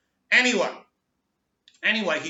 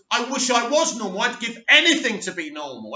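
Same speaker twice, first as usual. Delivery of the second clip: louder, higher plea in retold frustration; frustrated; 6 semitones higher, 6 dB louder than usual. Teenage son tells his father he wants normality, frustrated. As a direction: act out an exasperated teenage wish. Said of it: "Clark becomes very, very frustrated".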